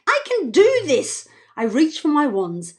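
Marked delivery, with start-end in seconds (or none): 0.1-1.3 high, eager self-encouragement; determined. A narrator's reading. Narrator voicing Evie to herself, determined. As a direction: psych yourself up.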